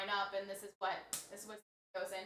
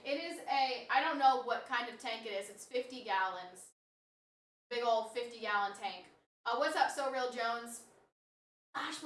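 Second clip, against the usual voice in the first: usual speaking voice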